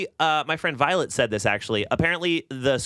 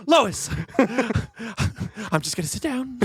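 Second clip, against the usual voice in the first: high, drawn-out cartoon husband calling his wife; slow cartoon dad impression, broken by laughing; Peter Griffin; 6 semitones higher, much slower than usual. Cartoon husband tells his wife he'll sit down, goofy impression. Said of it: "the most Peter Griffin ass fucking thing".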